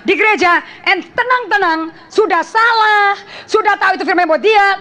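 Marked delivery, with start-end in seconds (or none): none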